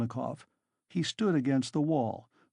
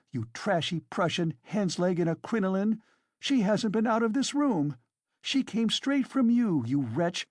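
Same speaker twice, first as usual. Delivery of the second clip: frantic railing; 8 semitones higher than usual. A narrator's reading. Katerina rails at landlady.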